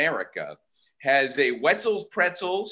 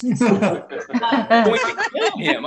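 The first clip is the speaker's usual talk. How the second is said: mock threat laughing; 6 semitones higher, 4 dB louder, much slower than usual. Dave voiced, laughing.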